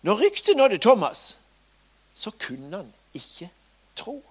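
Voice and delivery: ordinary delivery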